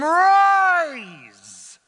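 high, loud, long drawn-out shout; thundering command. Acted. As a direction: call it out like a command from heaven.

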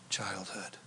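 breathy whispered word. Real, not acted. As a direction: let the word fall to a whisper. Prayer over painful memories.

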